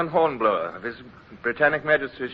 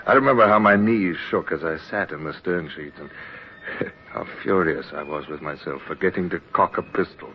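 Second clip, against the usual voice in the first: lower, reflective narrator voice; story narration; 6 semitones lower than usual. A narrator's reading. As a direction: look back on youth as an old man. Old Hornblower narrates past.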